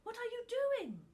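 high, loud frightened cry; little Tuft panics at the book; alarmed child. Acted.

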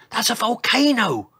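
high, loud exclamation; cry of alarm. A narrator's reading. Mother dinosaur recognising the danger, high loud cry. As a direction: cry out the discovery. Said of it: "cried Brenda".

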